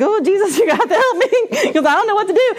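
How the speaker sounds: high, laughing half-begging prayer; mock desperate plea. Teacher praying in despair, a hint of laughing pleading. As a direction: beg Jesus for help, half joking. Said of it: "sobbing and begging".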